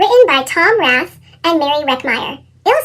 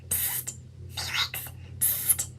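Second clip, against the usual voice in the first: breathy hissed psst; whisper; 23 dB quieter, slower than usual. A narrator's reading. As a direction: whisper to get his attention. Classmate whispering.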